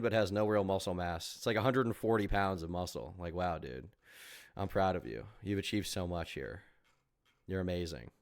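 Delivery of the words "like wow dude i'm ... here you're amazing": sarcastic praise. Pretend. Fake praise to gym guy.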